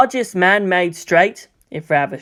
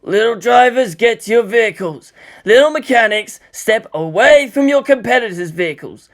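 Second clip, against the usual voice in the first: megaphone orders; 4 semitones higher, 4 dB louder than usual. A narrator's reading. Toji commanding kids by megaphone.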